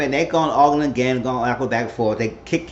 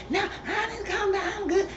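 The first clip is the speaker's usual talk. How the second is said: very high, softer mock irritation; irritated; 6 dB quieter than usual. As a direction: imitate someone testily insisting she is calm, pitched way up. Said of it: "Candace started getting irritated".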